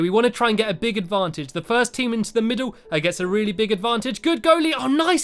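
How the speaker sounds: ordinary talk, nothing marked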